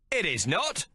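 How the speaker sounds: insisting. Acted. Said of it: "the waiter reiterates".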